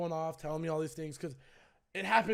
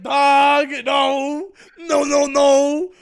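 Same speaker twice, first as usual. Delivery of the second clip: high, loud drawn-out no; retold outburst, stretched and high; loud exasperated protest; 7 semitones higher, 15 dB louder, much slower than usual. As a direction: cut him off, loud and insistent. Confronting friend in retold phone argument.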